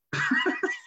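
sudden high burst of laughter. Real, not acted. Laughing as he recalls Steve's prank with a high burst. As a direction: laugh out at the trick.

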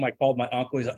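usual speaking voice

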